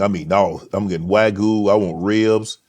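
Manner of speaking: ordinary talk, nothing marked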